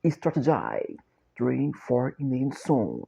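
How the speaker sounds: normal talking voice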